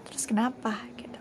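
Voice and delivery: whispered defiant question; a quick whispered so what. Real, not acted. Defiant aside to herself.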